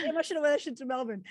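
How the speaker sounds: a sudden giddy thought, rushed; excited realization. Retold or imitated. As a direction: blurt it out, thrilled with the idea. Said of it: "I was like".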